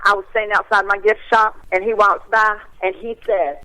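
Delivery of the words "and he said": ordinary talk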